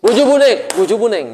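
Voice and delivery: high, loud startled "Buju buneng" then settling; Betawi latah exclamation. Retold or imitated. Teacher imitating a startled Betawi latah person, high loud exclamation. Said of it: "orang yang latih orang Betawi ya".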